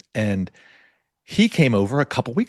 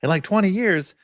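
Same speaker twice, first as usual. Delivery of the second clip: higher, excited disbelief; retold amazement; 8 semitones higher than usual. Telling the podcast host how the ankle felt, amazed and high. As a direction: squeal it out in happy shock. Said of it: "And I was like".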